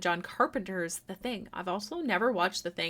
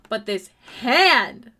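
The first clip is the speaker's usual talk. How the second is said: exclaiming; 9 dB louder, much slower than usual. Real, not acted. Gushing over drawn hand.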